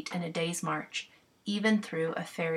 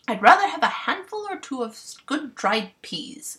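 louder, higher bumpkin giving orders; bossy clown voice; 6 semitones higher, 5 dB louder than usual. Acted. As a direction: boss the fairies like a proud clown.

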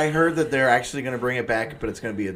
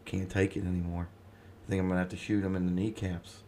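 conspiratorial gossip; 5 semitones lower, 9 dB quieter than usual. Real, not acted. Gossiping while cohost is away.

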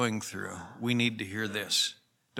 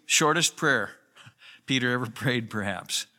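usual speaking voice

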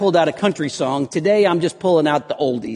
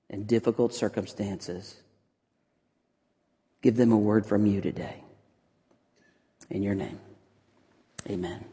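hushed, low-pitched prayer with long pauses; pastoral prayer; 5 semitones lower, 9 dB quieter than usual. Real, not acted. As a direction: pray softly and unhurriedly for the congregation. Leads congregation in prayer.

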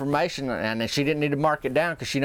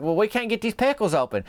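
higher, whiny complaint; mock complaint; 9 semitones higher than usual. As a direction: tattle that it won't open. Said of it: "And Mary Beth's like".

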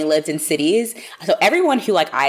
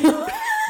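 high laugh. Real, not acted. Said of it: "I get very heated".

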